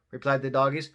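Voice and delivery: ordinary delivery, nothing marked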